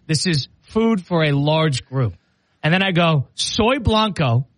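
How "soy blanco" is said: each word of "Soy blanco" drawn out slowly and carefully; slow, proud Spanish. Retold or imitated. Reading his order note aloud, slow and careful. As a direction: spell it out like a proud beginner. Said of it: "I said".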